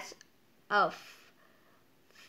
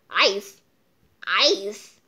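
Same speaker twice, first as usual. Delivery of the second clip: disbelief; 2 semitones lower, 8 dB louder than usual. Real, not acted. Reacting to moons made of ice.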